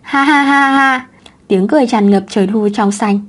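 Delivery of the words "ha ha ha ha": put-on slow, high, ringing child laughter; child's bright laugh. A narrator's reading. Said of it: "cô bé cũng cười đầy rạng rỡ". (tiếng cười khanh khách của bé gái)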